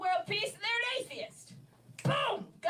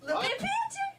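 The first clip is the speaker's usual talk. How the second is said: high giggling repeat of the line; laughing repeat; 12 semitones higher, faster than usual. Retold or imitated. Repeating her own joke while laughing.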